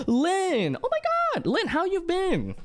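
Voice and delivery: very high gasping hello; excited greeting. Real, not acted. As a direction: squeal a delighted welcome to Lynn.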